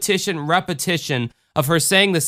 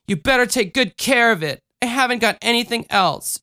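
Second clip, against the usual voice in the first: put-on higher little-girl voice, pleading; small child entrusting a treasure; child near tears; 10 semitones higher than usual. A narrator's reading. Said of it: "She started crying".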